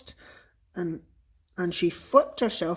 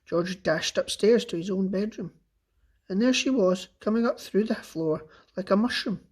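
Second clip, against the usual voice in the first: ordinary delivery, nothing marked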